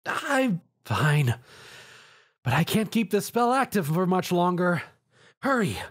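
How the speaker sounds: high, strained under effort; strained grunt. A narrator's reading. Twilight strains to hold the spell, high and tight. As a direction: push the words out while holding a spell. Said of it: "she grunted".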